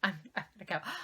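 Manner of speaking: soft, embarrassed retreat; meek mumble. Retold or imitated. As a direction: mumble it and back away. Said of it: "I was just like".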